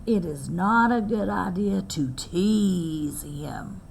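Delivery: slow whispered caution; whispered warning. Acted. Grandmother cautioning Squirrel about teasing: slow whisper. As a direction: whisper the lesson like a secret.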